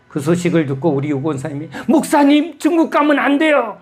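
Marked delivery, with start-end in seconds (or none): 1.9-3.8 put-on much higher, louder plea of an elderly deaconess; imitating a church elder about to cry; tearful pleading. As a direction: beg him not to go, on the verge of tears. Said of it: "눈을 막 우실 것 같이".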